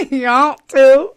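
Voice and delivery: loud, high, slow mock Southern accent. Echoing a challenge to compete, loud mock Southern drawl. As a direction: echo the Southern drawl, amped up.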